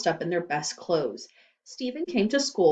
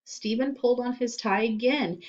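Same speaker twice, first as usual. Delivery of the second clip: plain reading voice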